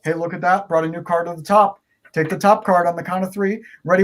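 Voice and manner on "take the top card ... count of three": normal talking voice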